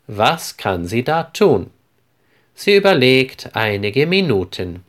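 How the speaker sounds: usual speaking voice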